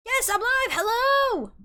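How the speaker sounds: high, bright hello to viewers; excited greeting. Real, not acted. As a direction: greet the chat with delight. Greeting the stream live.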